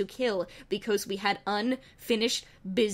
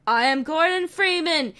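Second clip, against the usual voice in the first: heroic introduction; 6 semitones higher, 4 dB louder than usual. Acted. Gordon introduces himself.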